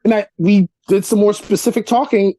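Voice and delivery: ordinary delivery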